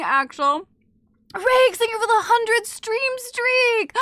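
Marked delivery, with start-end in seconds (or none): 1.4-3.9 high, drawn-out stream shout-out; big hyped announcement; excited thank-you. Real, not acted. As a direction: belt out the thanks slowly. Thanking a viewer for milestone.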